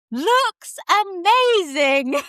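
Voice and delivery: high, very slow gushing praise; gushing friend. Retold or imitated. Doula friend reassuring mother, slow high gushing. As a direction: draw it out high and effusive. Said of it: "she's like".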